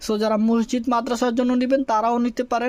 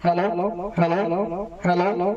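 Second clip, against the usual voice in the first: reduced mic echo; 4 semitones lower than usual. Shown as a demonstration. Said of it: "এই যে আমি ইকোটাকে কমাই দিলাম".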